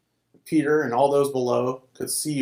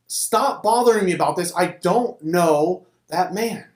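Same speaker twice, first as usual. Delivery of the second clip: louder, higher angry outburst slowing at the end; cursing; 5 semitones higher, 3 dB louder than usual. A narrator's reading. Peter cursing at the bystanders, angry. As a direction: snap it out, cursing. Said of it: "Peter started cursing".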